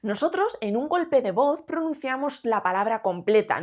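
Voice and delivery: ordinary talk, nothing marked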